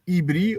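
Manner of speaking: "Ibri" with a clear B, eeb-REE; pronounced with B. Shown as a demonstration. Host gives the other pronunciation, "Ibri" with a B. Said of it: "or with a B".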